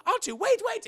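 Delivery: high, loud urgent yelp; panicked cry. Acted. C-3PO stopping R2 sawing, panicked yelp. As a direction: cry out to halt him, voice leaping up.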